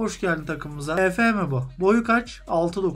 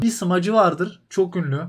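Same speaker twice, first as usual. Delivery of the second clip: normal talking voice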